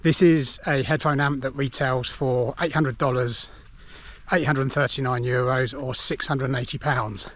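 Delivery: ordinary delivery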